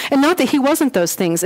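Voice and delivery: usual speaking voice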